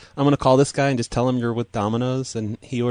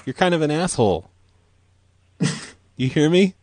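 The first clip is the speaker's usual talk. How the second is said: angry; 6 semitones higher than usual. Acted. Prank caller scolding customer.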